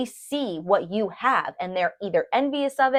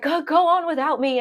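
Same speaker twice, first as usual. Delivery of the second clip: injured toy soldier; 9 semitones higher than usual. Retold or imitated. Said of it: "and then he's like".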